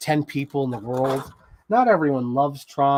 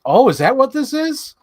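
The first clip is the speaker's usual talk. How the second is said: higher, louder mock surprise; sister's surprise; 11 semitones higher, 7 dB louder than usual. Sister watching the movie suddenly understands, higher and louder. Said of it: "And she's like".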